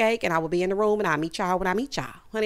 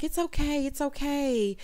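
soft, higher soothing voice; hushed, lifted "there, there" tone; mock consoling; 5 semitones higher, 5 dB quieter than usual. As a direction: imitate someone gently comforting a crying friend. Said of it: "she's consoling Jacqueline".

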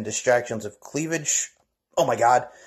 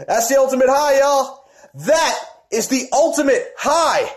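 promo tagline shout; 8 semitones higher, 3 dB louder than usual. Acted.

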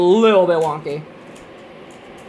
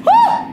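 short, very high shriek; high scream of alarm; startled scream; 6 dB louder than usual. Real, not acted. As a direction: yelp in sudden fright, high and loud. Startled by the game.

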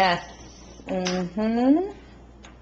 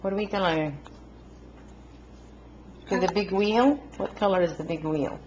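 sweet encouraging parent voice; 5 dB quieter than usual. Real, not acted. Parent coaching toddler while coloring.

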